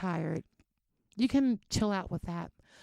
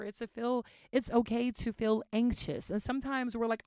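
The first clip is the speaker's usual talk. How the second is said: ordinary talk, nothing marked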